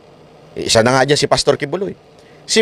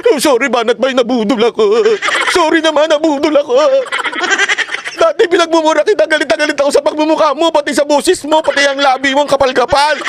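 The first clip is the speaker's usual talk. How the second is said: high, whiny mock apology with a laugh; mock apologetic convert; 9 semitones higher than usual. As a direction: mimic a sheepish ex-critic begging forgiveness, pitched well up. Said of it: "maniniwala na kayo na nabudol siya". (nanunuyang boses ng nagsisising tagasuporta, matinis)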